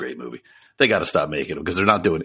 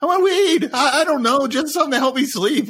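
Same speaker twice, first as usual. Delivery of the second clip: high-pitched, louder pleading outburst; comically worked-up, voice way up high; mock exasperation; 3 dB louder than usual. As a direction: act out a fed-up, clueless customer. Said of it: "I'm like".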